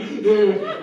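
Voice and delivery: big panicked gasp for air; a loud mock gasp, half laughing. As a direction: gasp like you're going under. Retells near drowning.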